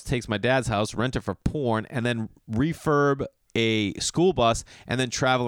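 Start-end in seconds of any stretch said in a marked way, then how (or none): none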